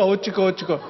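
mock Jeolla worrier fretting over nothing. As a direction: mutter 어찌까 like a Jeolla worrier. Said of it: "전라도 말로 어찌까".

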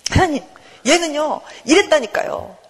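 high-pitched, peevish complaint; acted irritated tattling. Jonah tells on someone to God, peevishly complaining. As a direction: tattle to God with mock annoyance. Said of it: "화내고 짜증내고".

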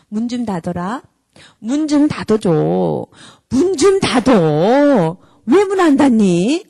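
hammed-up fed-up mum whining about her lot; nagging building to complaint. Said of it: "이러면서 나가서 하는 거 옳은 일이 아니에요".

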